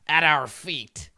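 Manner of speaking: imperious kobold king. Acted. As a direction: command them like a pompous monarch. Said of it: "and he says".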